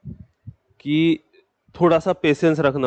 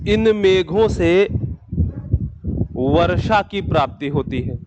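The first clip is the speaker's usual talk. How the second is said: measured dictation, raised pitch on the opening phrase; slow dictation; 3 semitones higher than usual. Real, not acted. Teacher dictating a line of notes to students, slow dictation.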